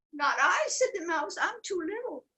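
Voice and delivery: squeaky high mouse voice; tiny mouse. Acted.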